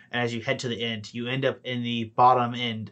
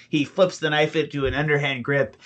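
normal talking voice

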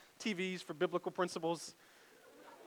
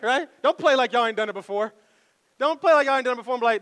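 ordinary talk

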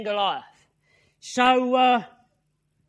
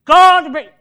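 loud retold vow of a young fighter; bold, forceful assurance; confident declaration; 11 dB louder than usual. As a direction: declare it firmly, punching it out.

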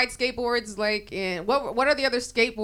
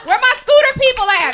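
shouting; 12 semitones higher, 7 dB louder than usual. Pretend. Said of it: "that I'm just like".

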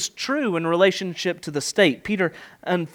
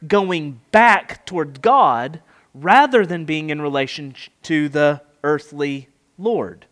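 normal talking voice

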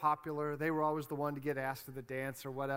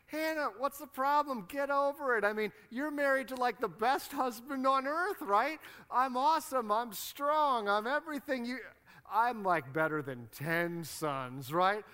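higher, louder mock-bragging voice; clueless, boastful husband; 8 semitones higher, 4 dB louder than usual. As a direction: imitate a self-satisfied husband. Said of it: "and he's like".

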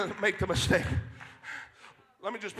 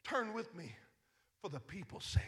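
quiet plea; 4 semitones lower, 15 dB quieter than usual. Retold or imitated. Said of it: "He said".

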